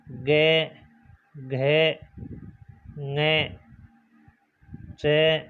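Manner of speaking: के, खे, गे, each said alone with its ए sound; reciting syllables. Shown as a demonstration. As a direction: read the syllables one by one, with pauses. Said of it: "जैसे हम लिखते हैं ना".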